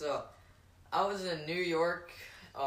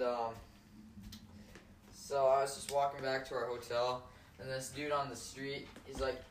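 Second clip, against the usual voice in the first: ordinary delivery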